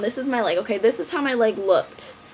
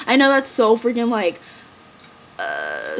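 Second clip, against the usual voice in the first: ordinary talk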